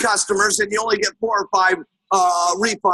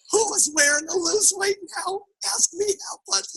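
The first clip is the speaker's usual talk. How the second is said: high, soft, squeaky explanation; tiny timid voice, hammed-up; meek wife; 9 semitones higher, 4 dB quieter than usual. Wife tells husband about the man's button, small and meek.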